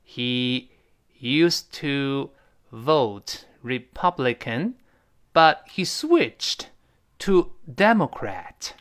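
slow, word-by-word English model sentence; slow model reading. Shown as a demonstration. Teacher demonstrates a new sentence for learners, slowly. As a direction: spell the sentence out slowly, pausing between phrases. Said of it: "那我们完整来一遍".

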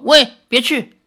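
high, loud cry to stop him; angry shout. A narrator's reading. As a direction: call him back crossly, pitch jumping. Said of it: "林月如气得用力跺脚".

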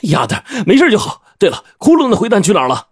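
lightly acted delight, pitched high; relieved and pleased, lifting the voice; beaming relief. A narrator's reading. Narrator glad his companion survived, beaming high-pitched relief. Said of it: "顿时喜笑颜开".

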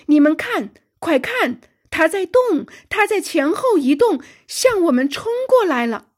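loud, high cry of alarm; urgent alarm. Acted. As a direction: shout a warning to the crew. Sudden alarm as monster charges. (惊急地高声呼喊)

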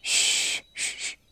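hushed "shh" sounds; a long shush, then short ones. Acted. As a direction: shush them with a soft hiss of breath. Secret voice hushes the visitors.

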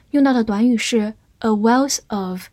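ordinary delivery

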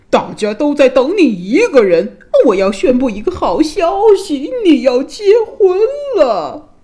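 loud, high-pitched proclamation; booming king. A narrator's reading. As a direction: bellow the news to the whole hall. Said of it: "国王用他的大嗓门喊着".

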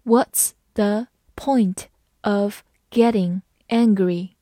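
one word at a time, evenly spaced; very slow, deliberate model sentence; slow reading. Shown as a demonstration. Teacher leads learners through the question, slow word-by-word reading. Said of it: "好，慢慢来读".

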